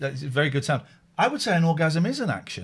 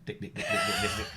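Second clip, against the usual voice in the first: very high clipped dick dick; high-pitched snipping noises; scissor sound; 8 dB quieter, faster than usual. Acted. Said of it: "That was the scissors".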